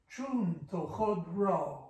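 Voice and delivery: Chun, to, chod, ra split into separate beats; scanning syllables. Shown as a demonstration. Teacher scanning the first foot for a student, syllable by syllable. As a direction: tap out Chun, to, chod, ra one syllable at a time. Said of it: "first foot".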